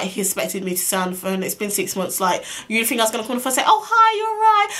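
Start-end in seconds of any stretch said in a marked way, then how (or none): 3.7-4.7 high, loud, fake-delighted squeal; sarcastically over-sweet, pitched way up; mock gushing greeting. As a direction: pretend to be thrilled by the caller, hammed up. Mocks the gushing greeting he expected.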